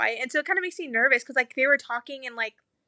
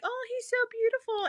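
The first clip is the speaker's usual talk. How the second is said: higher, softer cooing; admiring coo; 12 semitones higher, 4 dB quieter than usual. Retold or imitated. Staff admiring the newborn, higher cooing. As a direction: coo over the baby.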